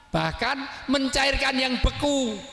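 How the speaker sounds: high, drawn-out sermon delivery; impassioned preaching. Real, not acted. Preaching at raised force. (khotbah bernada tinggi, diulur)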